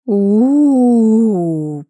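long high wavering "ooo", loud; eerie howl. A narrator's reading. Unseen creature in the dark nearby, eerie drawn-out howl. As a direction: howl it out long, rising then sinking. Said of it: "послышалось совсем близко".

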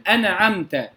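أنعمت with a stray short vowel after the noon; moved noon. Shown as a demonstration. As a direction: wrongly say أنعمت as ana-amta. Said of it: "السكون يكون صعبا معه". (تحريك نون أنعمت خطأً)

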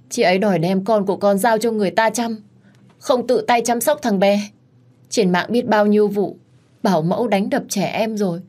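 acted tearful fuss; wailing complaint. A narrator's reading. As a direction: whine to an elder for sympathy, building to an accusation. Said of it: "nó tiếp tục bù lu bù loa lên".

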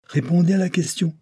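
high-pitched commanding exclamation; outburst. A narrator's reading. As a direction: order it sternly, pitch well up. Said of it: "avec un grand éclat de voix". (éclat de voix autoritaire, aigu)

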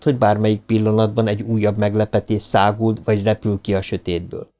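ordinary delivery

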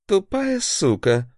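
high, slow spat-out insult; angry curse. A narrator's reading. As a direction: spit the insult out furiously. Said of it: "бросил он".

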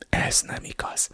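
whispered, very soft; hushed denial. A narrator's reading. Sister denies the narrator's accusation, in a whisper. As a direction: barely voice the denial.

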